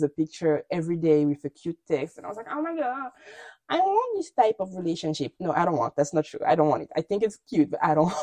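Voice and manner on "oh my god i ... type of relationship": soft, higher "oh my God" swoon; exaggerated dreamy longing; mock swooning. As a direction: gush as if melting over a cute text. Quoting own gushing reaction to texts.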